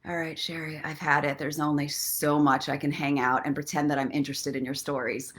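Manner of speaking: lightly voiced fed-up dog; bored dog Ollie. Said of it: "Ollie's like".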